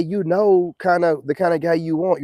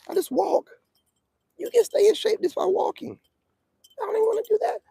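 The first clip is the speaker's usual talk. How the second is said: high playful aside. Acted.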